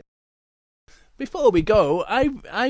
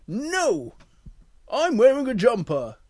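raised, emphatic refusal; polar bear voice; 6 semitones higher, 4 dB louder than usual. Acted. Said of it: "And the polar bear goes".

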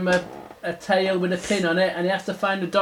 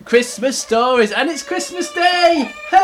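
put-on loud, higher-pitched children's host greeting; big cheery Christmas announcement; show-opening welcome; 7 semitones higher, 6 dB louder than usual. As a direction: welcome the kids with building excitement.

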